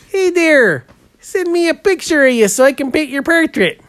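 high-pitched quoted hail; acted-out request. Painter acts out asking a client for a picture, high quoted voice. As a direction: pitch it well up, as if hailing a client.

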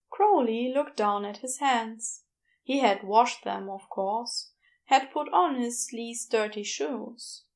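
usual speaking voice

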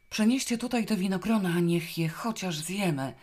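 loud, high-pitched glum demand; dejected demand. A narrator's reading. Zosia wants grapes brought as some comfort, dejected demand. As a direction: demand it loudly, thoroughly fed up. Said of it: "zażądała w końcu w przygnębieniu".

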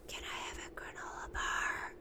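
put-on whispered request from a kid; child's whisper. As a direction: whisper it like a sneaky little boy. Son sneaking up during call.

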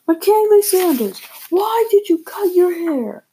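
high, slow scolding mother voice; angry mom yelling. Acted. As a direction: yell it like a furious mom.

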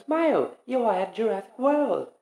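high-pitched imitation of a park greeting; recorded park voice. Imitating the park voice for a co-host, high announcement voice. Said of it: "when the voice goes like".

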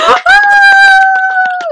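high, loud delighted laugh; loud shrieking laugh. Real, not acted. Reacting to the anniversary cheer, shrieking laugh. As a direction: burst into a screaming laugh.

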